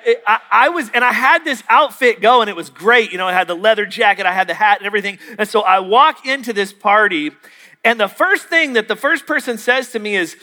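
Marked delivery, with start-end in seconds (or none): none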